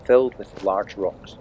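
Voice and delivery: usual speaking voice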